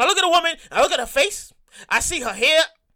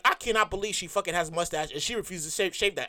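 low, much softer disbelieving aside; inner thought; 9 semitones lower, 9 dB quieter than usual. Retold or imitated. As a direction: mutter it under your breath. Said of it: "looking at her mustache like".

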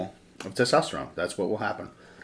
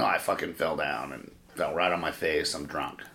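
low, soft, mumbled excuse; sheepish mumble; 7 semitones lower, 9 dB quieter than usual. Retold or imitated. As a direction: mumble a lame drunk excuse. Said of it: "My wife's like, "Oh, what happened?".

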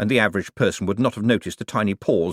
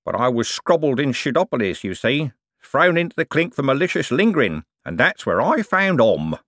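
slurred, whistling sibilants, pitched up; wheedling old con man; 6 semitones higher than usual. Acted. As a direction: play a crook lisping through bad false teeth. Plays Cribbins in dialogue.

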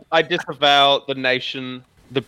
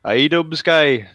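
put-on British YouTuber rambling; British lout grumbling about a rival; mock British. British commentator parody ranting about YouTube, mock British. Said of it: "imagine Leafy but British".

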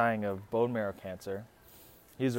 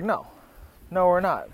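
raised, louder imitation of a firm no; mock refusal; 6 semitones higher, 5 dB louder than usual. The whole family answers dad's proposition, mock refusal. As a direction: imitate a chorus refusing, pitched up.

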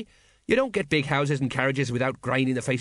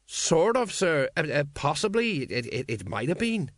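faltering, starts high and sinks; uncertain stammer; 4 semitones higher than usual. Acted. Sergeant answering his officer about the dragon, uncertain stammer. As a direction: stumble through a doubtful answer.

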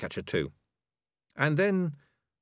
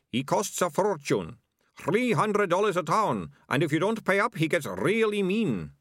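menacing sidekick; 9 semitones higher than usual. A narrator's reading.

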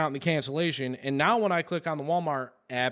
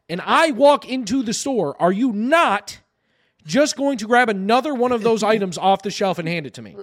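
raised voice, loud emphatic grilling; indignant challenge; 4 semitones higher, 7 dB louder than usual. Retold or imitated. Customer demanding a replacement TV from store staff, indignant. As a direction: corner the clerk with a loud, pointed question.

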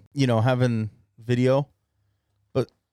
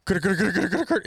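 rapid repeated imitated noise, climbing high; Tyler; 5 semitones higher than usual. Imitating co-host's noise as he stretches toward the snake, rapid and rising. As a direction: rattle it off as a rising comic noise. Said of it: "if they could see Tyler go".

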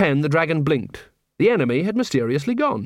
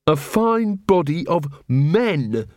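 slow, pointed repetition, pitched up; drawn-out, higher, offended quote; indignant quoting; 4 semitones higher, much slower than usual. A narrator's reading. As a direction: repeat it deliberately, stung by it. Angua repeats the muttered comment.

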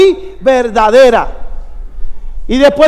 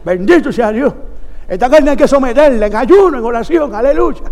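lower-pitched mimicry of an evangelist; Yiye Ávila; 3 semitones lower than usual. Preacher imitating evangelist warning brother about the flesh, playful impression. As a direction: imitate an earnest old preacher's exhortation. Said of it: "Gille se agarraba así y decía".

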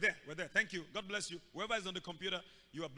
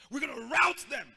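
louder, rout punched hard; bible language; 5 semitones higher, 8 dB louder than usual. Shown as a demonstration. Preacher booming out the Bible version. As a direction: proclaim it like scripture. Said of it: "That's Bible language".

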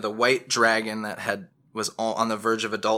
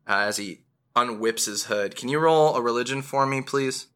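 ordinary talk, nothing marked

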